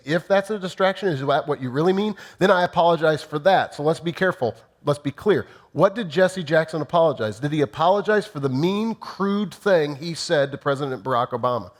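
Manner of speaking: ordinary delivery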